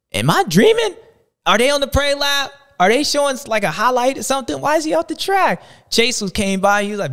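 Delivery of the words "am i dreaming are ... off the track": high, loud, bewildered questions; stunned disbelief. Retold or imitated. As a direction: act baffled, as if you can't believe your eyes. Retelling stunned crowd watching Eli.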